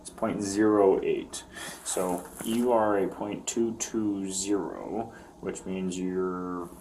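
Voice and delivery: slow, careful delivery of the numbers; slow and deliberate. Real, not acted. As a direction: spell the result out slowly. Officer stating breath test result.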